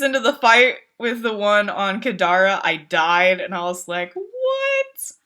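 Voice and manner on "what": very high outraged disbelief; shocked squeal. Retold or imitated. Died five seconds in.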